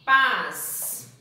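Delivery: "Paz" read loud as one syllable; loud blended syllable. Shown as a demonstration. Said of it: "A gente vai ler tudo junto". ("Paz" bem alto, tudo junto)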